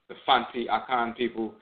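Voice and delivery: ordinary talk, nothing marked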